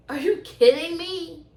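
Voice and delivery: slow, high are-you-kidding-me; exasperated disbelief. Real, not acted. Vlogger venting over missing rental coverage, slow high disbelief. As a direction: drag it out in disbelief.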